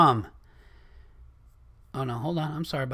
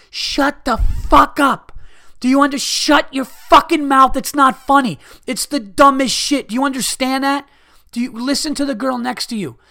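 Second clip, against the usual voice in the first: furious shout, much higher and louder; imagined screaming; 9 semitones higher, 6 dB louder than usual. Pretend. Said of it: "while screaming in his face".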